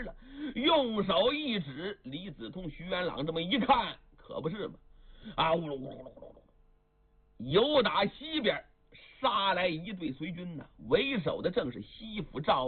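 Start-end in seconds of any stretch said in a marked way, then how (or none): 5.4-7.5 a breathy whirring whoosh, fading away; whooshing hammer noise. Acted. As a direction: mimic the hammers swishing through the air.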